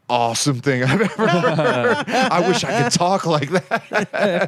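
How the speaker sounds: laughing. Retold or imitated. Laughing while recalling his reaction.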